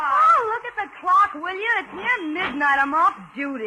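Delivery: high, pleading protest, off duty at last; weary protest. Acted. Mildred begs Ruth to let her off duty, high pleading protest. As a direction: beg off the last car. Tired car hop protests to coworker.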